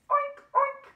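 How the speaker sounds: two high, loud oinks; squealy piggy noise. Pretend. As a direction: imitate a pig for children. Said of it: "she makes a noise like this".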